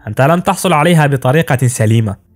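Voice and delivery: ordinary delivery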